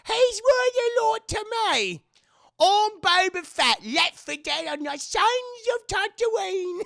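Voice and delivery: hammed-up, badly faked New Zealand accent, loud and high; Boba Fett. Streamer failing the other Boba voice, exaggerated New Zealand accent. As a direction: stretch the vowels into a slow, shouted mock New Zealand accent. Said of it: "I can't do the other one".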